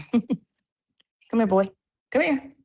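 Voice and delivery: high sing-song call to the dog. Real, not acted. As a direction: coax the puppy over, voice up high.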